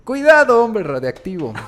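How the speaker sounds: high, loud hammy warning; campy hero shout. Retold or imitated. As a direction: yell it like a sixties TV hero. Quoting campy old Batman line. (grito exagerado de héroe)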